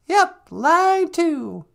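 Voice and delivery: high falsetto, drawn out very slowly. Acted. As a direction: stretch it out high and slow. Put-on voice announcing call.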